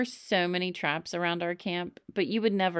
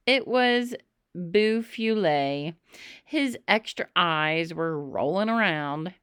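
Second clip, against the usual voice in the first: ordinary delivery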